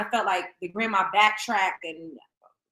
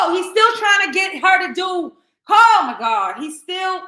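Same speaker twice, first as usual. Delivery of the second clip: exasperated outburst; 8 semitones higher, 7 dB louder, slower than usual. Real, not acted. Outraged at father's persistence.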